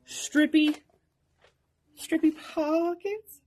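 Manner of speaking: high, halting "strippy, strippy pockets"; oddly said. Real, not acted. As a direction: pitch it up high and pause before repeating "strippy". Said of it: "Why did you have to say it like that".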